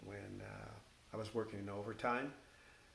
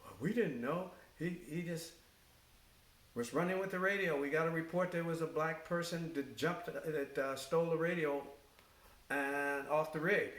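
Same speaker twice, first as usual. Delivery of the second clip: retold defensive excuse; 7 semitones higher, 5 dB louder than usual. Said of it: "And the guys go".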